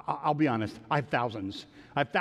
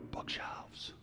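whispered suggestion; 16 dB quieter than usual. Retold or imitated. Friend's suggestion quoted on landing.

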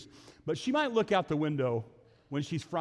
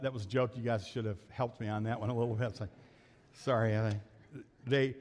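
ordinary talk, nothing marked

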